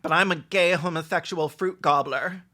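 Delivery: ordinary talk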